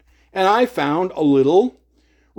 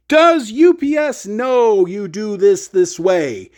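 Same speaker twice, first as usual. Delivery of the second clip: slow, deliberate retold challenge; slow, insistent; 3 semitones higher, slower than usual. Customer repeating the challenge after being brushed off, slow and insistent. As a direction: spell it out word by word, insisting.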